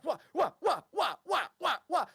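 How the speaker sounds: high, repeated swooping wahs; rhythmic falling "wah" sounds; whirling weapons noise. Acted. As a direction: imitate weapons flung up one by one. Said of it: "he's throwing weapons into the air".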